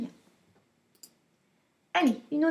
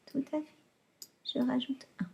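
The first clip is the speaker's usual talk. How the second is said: whisper; 10 dB quieter, much faster than usual. Shown as a demonstration. Aside whispered to class.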